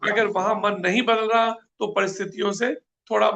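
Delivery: usual speaking voice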